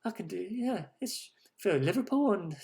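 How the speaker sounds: put-on British accent. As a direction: ham up a rubbish British accent, Liverpool-ish. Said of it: "'cause I really like doing a British accent".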